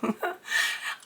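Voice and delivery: breathless hysterical giggle; hysterical laugh. Real, not acted. Startled by a goat at the door, hysterical laughter. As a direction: laugh helplessly, half terrified. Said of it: "I got hysterical".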